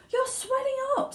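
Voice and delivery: very high frantic cry; horrified mother. Acted. Mother screams as Violet swells. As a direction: shriek in disbelief. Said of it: "screamed Missus Beauregard".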